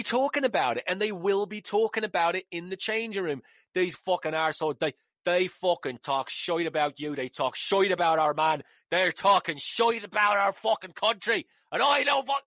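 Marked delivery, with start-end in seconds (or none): 3.7-12.4 bad Irish accent. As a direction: rant like an outraged Irish player in a hammed-up accent. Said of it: "That shitty Irish accent".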